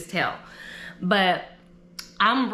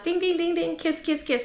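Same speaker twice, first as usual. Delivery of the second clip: mimicked glass ding; 10 semitones higher than usual. Guests clinking glasses at reception.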